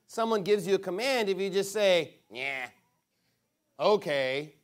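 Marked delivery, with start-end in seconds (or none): 2.3-4.5 offhand, careless agreement; casual yeah okay. Shown as a demonstration. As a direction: toss off a lazy yeah okay. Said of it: "it doesn't have the same ring to it".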